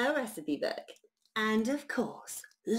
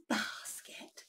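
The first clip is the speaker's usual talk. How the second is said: ordinary delivery, nothing marked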